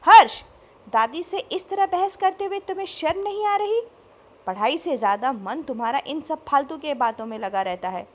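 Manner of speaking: very loud, high roar of the name, then scolding; father roaring at his son for arguing, loud; thundering. A narrator's reading. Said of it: "पापा एक बार फिर गरज उठे".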